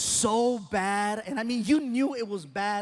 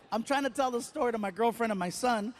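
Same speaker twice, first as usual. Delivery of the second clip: normal talking voice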